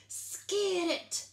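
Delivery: holler. A narrator's reading.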